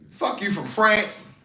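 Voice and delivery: normal talking voice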